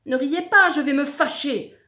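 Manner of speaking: loud, high, indignant monster; offended monster shout; angry warning. A narrator's reading. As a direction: thunder at the crowd to stop. Monstre vexé par les rires.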